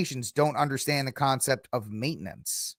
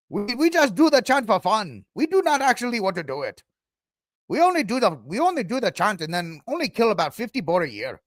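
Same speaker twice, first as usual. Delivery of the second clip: high-pitched fake African accent sliding off course; bad African accent; 6 semitones higher than usual. Host mimicking Africans excusing the chant, bad African accent. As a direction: badly imitate an African accent, explaining it away. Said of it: "My African accents are bad".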